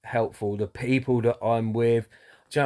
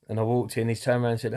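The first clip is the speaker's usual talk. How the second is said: normal talking voice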